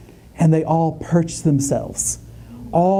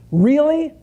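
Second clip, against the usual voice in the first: much higher, incredulous "Really?"; a pitched-up disbelieving complaint; exasperated really; 10 semitones higher than usual. Retold or imitated. To Marshall after the pole hits his head, incredulous. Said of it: "in the natural".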